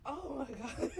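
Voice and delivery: high, loud laugh-cry; overwhelmed, breaking into laughter; real overwhelmed laughing. Real, not acted.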